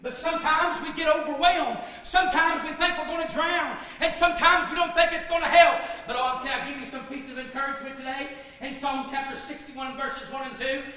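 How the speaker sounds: loud, impassioned sermon delivery; ringing pulpit proclamation; full-force preaching. Real, not acted. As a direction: preach it out loud, leaning hard on key words.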